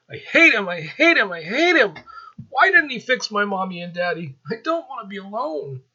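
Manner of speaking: put-on high, loud child's voice crying out in hurt; a small boy wailing his hate through tears; grieving child. Orphaned boy to Azrael, a high wailing child's cry.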